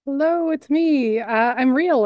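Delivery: ordinary delivery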